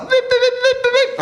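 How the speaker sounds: high, loud imitated beeping; parking sensor beeps. Comedian imitating a car parking sensor, high beeps. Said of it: "internal parking sensor".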